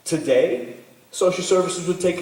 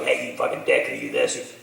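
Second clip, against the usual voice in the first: drops low and sneers; mocking bully voice. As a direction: play the rude kid across the line. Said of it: "It's just like the word that you said, like".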